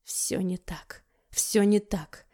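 whispered, slow, barely voiced; whispering. A narrator's reading. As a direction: whisper it in shock. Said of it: "прошептал младший Муромцев". (шёпотом)

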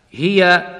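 ordinary delivery

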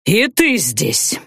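slow menacing growl; cold rasping evil emperor. A narrator's reading. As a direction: growl it like a cold dark lord. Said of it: "прорычал Анхайлик".

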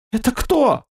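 high frightened squeak. A narrator's reading. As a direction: squeak it out in fear. Frightened merchant asks.

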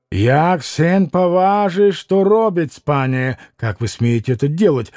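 ordinary delivery

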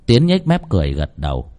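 plain reading voice

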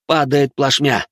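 usual speaking voice

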